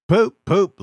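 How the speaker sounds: very high, loud whistle call; whistle-like poop. A narrator's reading. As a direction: toot like a whistle. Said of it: "he whistled".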